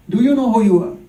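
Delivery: loud, challenging question as Krishna; emphatic question. Acted. Krishna questioning Arjun, loud and emphatic. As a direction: put it to him loudly, falling at the end.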